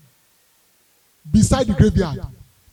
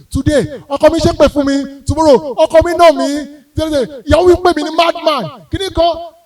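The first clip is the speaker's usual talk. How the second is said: rising, louder mock grievances; exasperated complaints; 6 semitones higher, 7 dB louder than usual. Comedian mimicking nagging callers, exasperated and loud.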